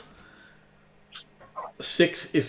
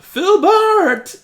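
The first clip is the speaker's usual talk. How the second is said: high, loud call across the battlefield; yelling out; 9 dB louder than usual. Acted. Game master voices a healer running over to an ally, yelling out. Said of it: "and yell out".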